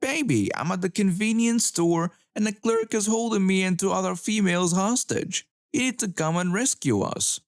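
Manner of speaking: put-on high, whiny baby voice. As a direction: whine it up high like a pouting child. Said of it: "in a whiny voice".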